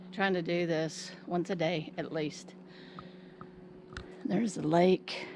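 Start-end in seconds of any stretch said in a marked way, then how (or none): 4.3-5.3 breathless from the climb; panting between words; out of breath. Real, not acted. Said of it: "Out of breath".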